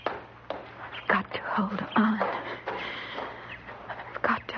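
slow, hushed self-talk; drawn-out whisper, close to breaking; desperate whisper. Acted. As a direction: whisper slowly to hold yourself together. Wife alone, steeling herself.

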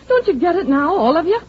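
ordinary talk

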